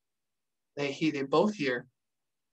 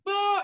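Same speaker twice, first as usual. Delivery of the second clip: high imitated "ah" of a soldier; quick high yelp, choosing to fight; imitated battle cry; 14 semitones higher than usual. Soldier choosing fight over flight, imitated cry. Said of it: "Balco then goes like".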